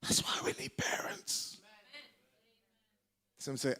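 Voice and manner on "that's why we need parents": forceful stage whisper; intense whisper. Real, not acted. Preacher telling congregation why parents are needed, intense whisper. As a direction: push it out as an emphatic whisper.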